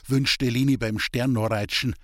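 ordinary delivery, nothing marked

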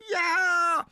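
very high, loud squeaky ghost shout. Acted. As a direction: yell it as the tiny ghost.

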